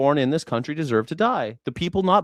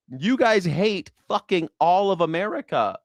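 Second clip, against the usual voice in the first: normal talking voice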